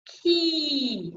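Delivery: sustained "Ki" sliding down; high-tongue vowel. Shown as a demonstration. Coach leading a try at "Ki", long and pure. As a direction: hold "Ki" out, tongue blades high. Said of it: "Try that".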